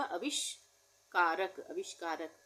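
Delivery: आविष्कारक drawn out syllable by syllable; slowly sounded out. Shown as a demonstration. Teacher dictating to pupils, very slowly sounded out. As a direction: stretch आविष्कारक so children hear each part.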